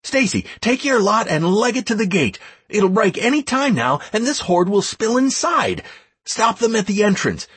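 high, pressing battlefield commands; shouting orders. A narrator's reading. Leader sending Stacy's lot to the gate, shouting orders. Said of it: "I continued to shout orders left and right".

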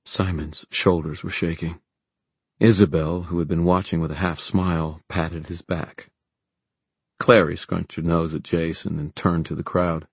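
ordinary delivery, nothing marked

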